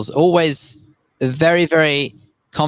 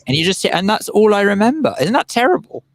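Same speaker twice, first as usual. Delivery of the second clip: normal talking voice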